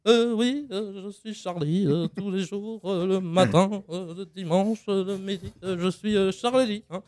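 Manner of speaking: slow hesitant put-on voice; a slow, hesitating Semoun; Élie Semoun impression. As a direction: drag each euh, dim and slow. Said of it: "Élie Semoun".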